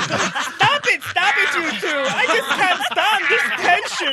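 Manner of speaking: high, hammed-up distress; overwrought plea. Partner breaking up host and guest's fight, overwrought plea. Partner pleads during comic quarrel.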